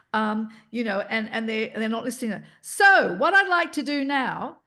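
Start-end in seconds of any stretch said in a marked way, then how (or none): 2.6-4.6 higher, louder twangy call to the room; twang voice. Shown as a demonstration. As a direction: cut through an unruly room with twang. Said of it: "go into that bit of twang".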